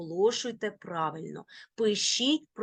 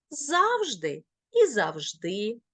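завжди stressed first on the first syllable, then on the last; double stress. Shown as a demonstration. Teacher showing both allowed stresses, double stress. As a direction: say завжди both ways, stress moving from front to end. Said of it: "можуть наголошуватися бінарно".

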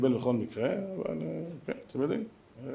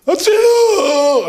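long drawn-out scream, much higher and louder; desperate cry for help; 12 dB louder, much slower than usual. Acted. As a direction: howl it out in panic, slowly. Paralysed rich man cries for help.

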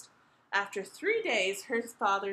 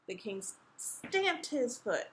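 ordinary delivery

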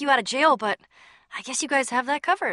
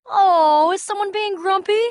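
high, loud mock-sweet teasing; 7 semitones higher, 5 dB louder than usual. Teasing a grumpy friend in a mocking tone. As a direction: needle him in a singsong.

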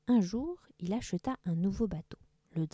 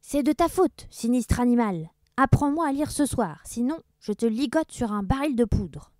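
higher, louder pirate threat; angry threat; 4 semitones higher, 6 dB louder than usual. Acted. Blackbeard blames the teacher, loud threat.

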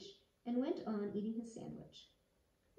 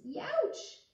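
sharp yelp; 12 semitones higher, 5 dB louder than usual. Acted. Spines prick the frog.

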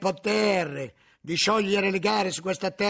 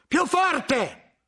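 a sharp, higher, louder command; inquisitor's shout; 9 semitones higher, 3 dB louder than usual. A narrator's reading. The inquisitor orders the frightened cellarer to speak louder, shouting. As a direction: bark it out to force him to speak up. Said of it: "gridò Bernardo".

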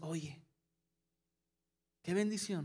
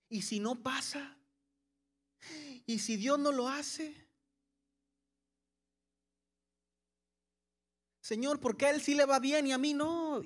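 fearful doubting; 4 semitones higher than usual. Retold or imitated. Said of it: "con temor".

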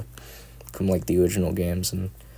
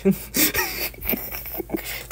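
high, soft breathy giggle; breathless laugh; 8 dB quieter than usual. Real, not acted. Laughing at own goth voice.

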